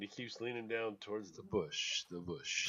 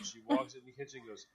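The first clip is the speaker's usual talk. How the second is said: ordinary talk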